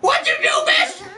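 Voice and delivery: shrieking. Acted. Shrieking at a character.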